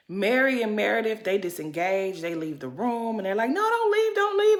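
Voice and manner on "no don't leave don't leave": high-pitched mock plea; mock pleading. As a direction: imitate them begging, voice way up high. Retells others begging them to stay.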